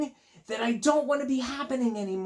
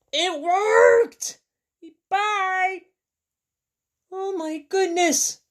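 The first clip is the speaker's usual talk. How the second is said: much higher, louder delighted cry; excited squeal; 13 semitones higher, 7 dB louder, slower than usual. Acted. Elephant thrilled the birds left. Characters react, high voices.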